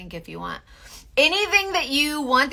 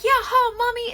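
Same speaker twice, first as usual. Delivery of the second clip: put-on high, insistent child; little son voice; 14 semitones higher, 6 dB louder than usual. Boy insisting to mommy, high child voice. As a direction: protest like a toddler. Son's voice in tickle story.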